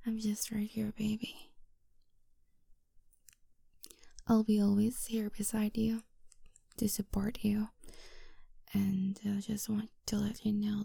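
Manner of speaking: soft breathy whisper close to the ear. Acted. Girlfriend whispering to her grieving boyfriend, soft whisper. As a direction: whisper it gently, like comforting a partner.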